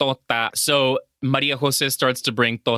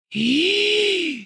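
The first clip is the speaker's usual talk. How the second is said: shocked gasp. Real, not acted.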